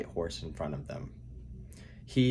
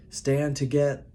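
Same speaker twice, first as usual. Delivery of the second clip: loud, high shouted command; battle shout; 8 semitones higher, 8 dB louder, slower than usual. A narrator's reading. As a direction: yell across the battlefield. Battle call in narrated fight.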